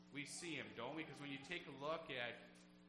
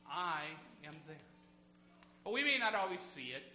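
full-force preaching; 3 semitones higher, 9 dB louder than usual. Acted. Pastor preaching against fear to his flock, fervent and loud. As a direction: drive God's promise home to the pews.

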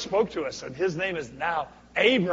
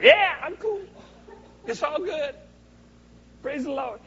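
high, breezy mock nonchalance; carefree party attitude; 11 semitones higher than usual. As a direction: play the smug believer on a good day. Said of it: "We're like".

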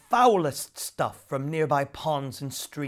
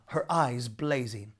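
ordinary delivery, nothing marked

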